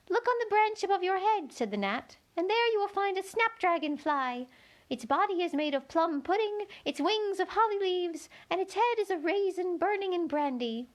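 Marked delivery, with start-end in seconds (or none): 0.1-1.5 high, thin little insect voice; a tiny gnat piping up to Alice. A narrator's reading. Gnat telling Alice where to look, high and thin. 2.4-10.9 high, thin little insect voice; a tiny gnat piping up to Alice. A narrator's reading. Gnat telling Alice where to look, high and thin.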